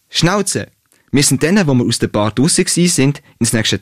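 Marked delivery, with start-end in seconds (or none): 0.1-0.7 high, quick angry cut-in; shouted Schnauze. A narrator's reading. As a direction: bark him into silence. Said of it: "schreit er schnell in ihren Satz".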